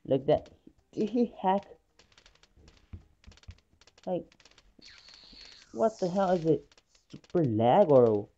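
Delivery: normal talking voice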